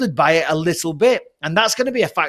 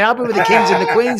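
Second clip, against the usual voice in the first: lightly posh, a little higher, lording it; mock Polish royalty; 3 semitones higher, 3 dB louder than usual. Boasting to mates as a mock count, lightly posh. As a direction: play the grand count, only half-serious. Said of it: "I'm a Polish count".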